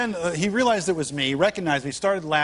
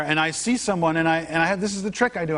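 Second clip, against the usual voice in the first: ordinary delivery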